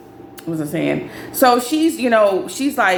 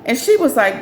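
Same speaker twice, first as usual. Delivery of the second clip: usual speaking voice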